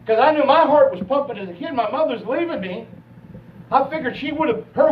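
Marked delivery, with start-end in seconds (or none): none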